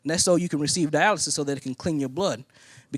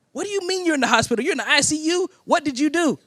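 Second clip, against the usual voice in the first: high, fast, alarmed questioning; freaking out; 11 semitones higher than usual. Retold or imitated. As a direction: rattle it off high like a panicked wife. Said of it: "she freaks out over the phone".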